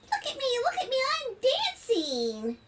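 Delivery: high, sing-out little spider voice; show-off spider. Acted. Spider dancing for the family, excited showing off. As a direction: call out proudly, stretching the last words.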